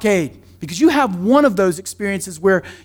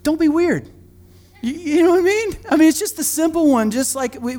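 usual speaking voice

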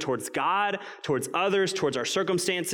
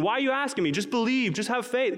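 much higher, eye-rolling impatient voice; mock impatient God; 9 semitones higher than usual. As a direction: play an exasperated God brushing off questions. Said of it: "God's not rolling his eyes going".

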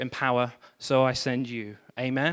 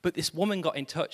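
ordinary talk

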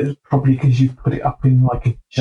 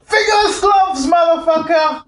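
high-pitched drawn-out shout; mock outburst; 13 semitones higher than usual. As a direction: yell it like an over-excited outburst. Mock outburst answering a question.

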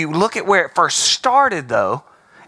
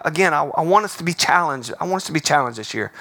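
ordinary delivery

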